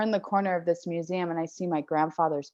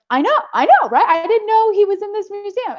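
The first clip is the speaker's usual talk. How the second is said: high, loud burst of amazed excitement; breathless squeal of wonder; excited disbelief; 13 semitones higher, 7 dB louder than usual. Retold or imitated. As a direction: gush it out like you can't believe your luck. Excited retelling of finding grandfather.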